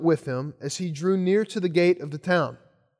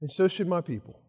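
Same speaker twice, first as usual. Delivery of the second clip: gentle voice of Jesus. Retold or imitated. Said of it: "And Jesus says".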